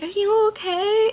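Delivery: high-pitched imitated concern; concerned staff voice. Staff asking sad patient, high concerned voice.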